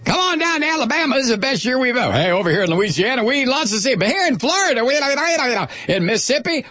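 high, fast hammed-up Southern pitchman; mock a gushing beach ad; cheesy tourism ad. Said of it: "the ads that are being paid for by B P".

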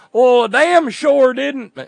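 high, loud, slow Southern drawl. Acted. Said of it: "I know, wrong accent".

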